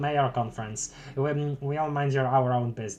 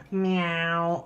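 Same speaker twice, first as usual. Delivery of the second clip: cat meow; 3 semitones higher than usual. Pretend.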